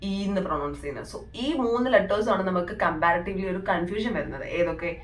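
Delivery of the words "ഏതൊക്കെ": usual speaking voice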